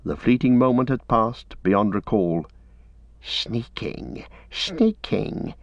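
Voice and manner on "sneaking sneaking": sibilant, offended creature hiss; hissing Gollum. A narrator's reading. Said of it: "he hissed".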